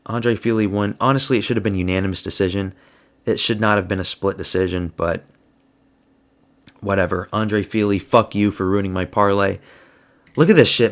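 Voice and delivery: ordinary delivery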